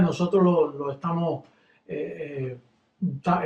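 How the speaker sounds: ordinary talk, nothing marked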